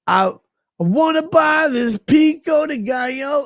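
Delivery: high, loud mocking shout of a demanding woman; mock shrill woman. Retelling a woman's tantrum in a high mock shout. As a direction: screech it like an entitled woman.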